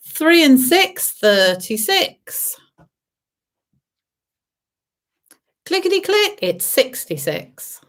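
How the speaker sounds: lilting bingo call, high on the rhyme; bingo caller. Acted. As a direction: sing out the numbers. Bingo calls.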